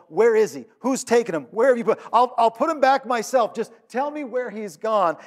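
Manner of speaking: loud, high-pitched urgent demands; desperate to know. Acted. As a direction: plead insistently as a frantic searcher. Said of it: "she just needs to know".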